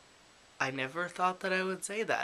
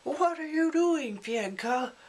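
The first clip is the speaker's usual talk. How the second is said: much higher and louder, a mock outraged yell; indignant shout; 13 semitones higher, 5 dB louder than usual. Sasha yells at Fyedka in mock outrage. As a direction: throw the question at him in mock outrage. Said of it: "Sasha's like".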